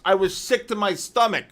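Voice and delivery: ordinary talk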